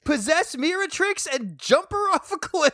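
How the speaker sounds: high, loud, laughing disbelief; exasperated outburst. Pretend. Host telling a ghost character what to do, exasperated. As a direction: urge it loudly in a high, incredulous voice.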